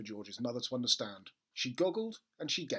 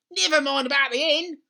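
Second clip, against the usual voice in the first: put-on high, loud jealous old woman; grandma shouting down for attention; grumpy grandma shouting; 14 semitones higher, 14 dB louder than usual. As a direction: demand attention, shrieking.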